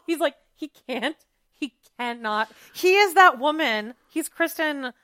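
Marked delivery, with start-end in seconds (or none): none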